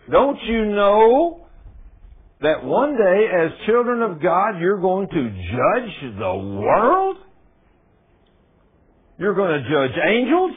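high, loud, very slow questioning; preaching at full force. Acted. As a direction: drag each question out to the crowd. Repeats verse to congregation.

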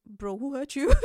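a teasing, caring mate who sees right through you; concerned mate. Retold or imitated. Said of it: "they'll be like".

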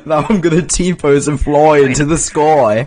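loud, high mock "Crikey mate" voice; hammed-up Aussie slang, laughing; mock Aussie Jesus. Friend mocking Jesus in a loud Aussie accent.

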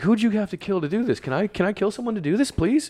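high-pitched, half-joking begging; desperate pleading. Retold or imitated. Acting out his envy at mansions.

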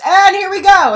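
high, loud, eager "here we go"; excited announcing. Retold or imitated. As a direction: announce the start with glee. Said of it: "and she goes".

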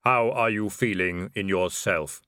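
calm, even, hollow voice of Death. Acted. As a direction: speak as if nothing could hurry you. Death speaks.

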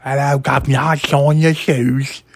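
hammed-up lisping Cockney, high and loud; mock a bad Cockney with a lisp, stretched out. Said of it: "He has that lisp too".

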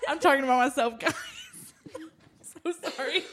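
high voice cracking up with laughter; laughing apology. Real, not acted. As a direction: apologise helplessly through laughter.

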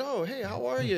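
very high-pitched polite hello; acted friendly greeting. Pastor greeting an online viewer he just met, high-pitched acted hello. Acting out own greeting to stranger.

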